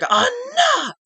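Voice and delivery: a huge, high-pitched yell of Enough!; furious shout. Acted. The boss silencing two feuding wrestlers with a piercing shout. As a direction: cut the whole room off with one scream.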